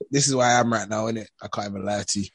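usual speaking voice

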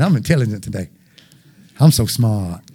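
mock boast. Preacher jokingly boasting to the congregation about being smart.

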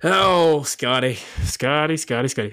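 ordinary delivery

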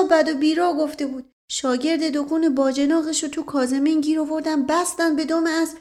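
put-on folksy servant in Isfahani accent. Mash Qasem voiced again. (لهجهٔ اصفهانی)

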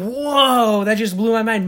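mind-blown exclamation; amazed reaction. Retold or imitated. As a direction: let the WHOA ring out in wonder. Retells own amazed reaction.

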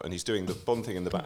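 ordinary talk, nothing marked

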